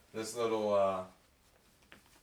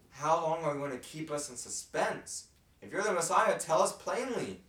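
raised, pressing crowd demanding an answer; exasperated demand; 8 semitones higher, 7 dB louder than usual. Retold or imitated. Crowd pressing Jesus to say plainly who he is, exasperated. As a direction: push them to speak plainly, half-shouting. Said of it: "And here they are asking".

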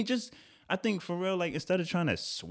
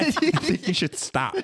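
laughing advice; 7 semitones higher than usual. Real, not acted.

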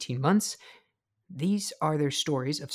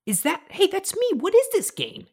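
surprised; 3 dB louder than usual. Real, not acted. Surprised at seeing himself.

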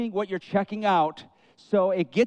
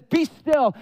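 commanding call; 7 semitones higher, 4 dB louder than usual. Retold or imitated. Jesus commands the waves.

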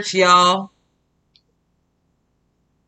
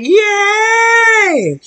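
much higher, louder cheering shout; crowd cheer; 6 dB louder than usual. Retold or imitated. Imitating the audience cheering at the DJ, loud shout. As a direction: scream it like a whole hyped crowd. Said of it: "everybody in the audience like".

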